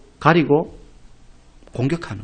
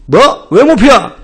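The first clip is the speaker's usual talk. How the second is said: loud, higher sharp demand; angry challenge; 5 semitones higher, 6 dB louder, slower than usual. Retold or imitated. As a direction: bark it at the insolent recruit. Squad leader angrily challenging.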